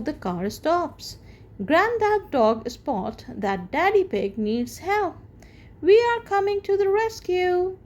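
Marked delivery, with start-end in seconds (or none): 5.8-7.8 high, fast, eager call. Acted. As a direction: call out cheerfully to the rescue. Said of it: "he calls out".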